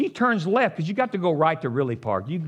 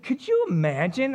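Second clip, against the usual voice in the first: normal talking voice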